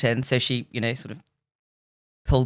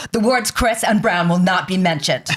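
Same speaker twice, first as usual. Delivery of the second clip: higher-pitched put-on official voice; imitating stern minder; 4 semitones higher than usual. [name]'s minder warning the interviewer, mock-stern and higher. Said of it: "And she was like".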